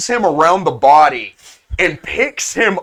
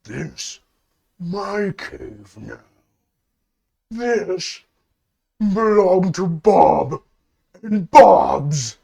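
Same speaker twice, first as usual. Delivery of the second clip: possessive Bob; 2 semitones higher, much slower than usual. Acted. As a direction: lay slow, heavy claim to the cave. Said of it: "He says".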